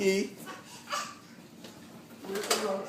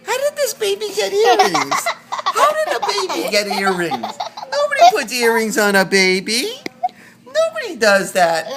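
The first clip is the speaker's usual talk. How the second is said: laughing; 12 semitones higher, 6 dB louder than usual. Real, not acted. Laughing at the earrings.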